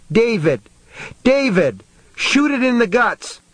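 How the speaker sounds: high, urgent yell; frantic high-pitched scream; father's scream. A narrator's reading. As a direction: cry out high to urge him on. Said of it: "his father screamed".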